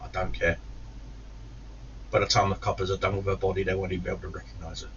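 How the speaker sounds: soft, quick muttered threat; cold menace. Retold or imitated. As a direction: let the words drop quietly, beyond caring.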